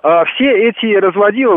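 ordinary talk

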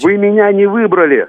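loud, high indignant retort; angry shout. Real, not acted. Guest argues back with host.

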